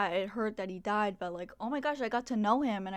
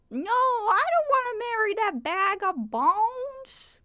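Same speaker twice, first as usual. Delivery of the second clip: high, loud, slow whiny cartoon voice; Master Shake impression; 11 semitones higher, 8 dB louder, slower than usual. Quoting Master Shake from Aqua Teen Hunger Force, whiny and high. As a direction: whine it like an indignant cartoon milkshake. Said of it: "where Master Shake's like".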